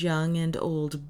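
ordinary delivery

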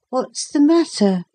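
put-on high-pitched drowsy boy's question; sleepy boy. A narrator's reading. Boy woken by a soldier, sleepy. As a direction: ask it as a boy just woken. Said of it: "said Philip sleepily".

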